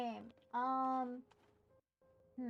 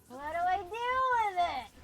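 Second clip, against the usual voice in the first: exasperated wail; 4 semitones higher, 9 dB louder than usual. Real, not acted. Stuck player asking what to do with a game object, exasperated wail. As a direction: wail it out in frustration.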